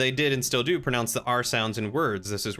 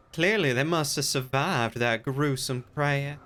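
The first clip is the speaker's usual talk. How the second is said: mock Southern accent; 4 semitones higher than usual. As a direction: put on a syrupy Southern accent, a bit higher. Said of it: "That's an accent I wish I could do well".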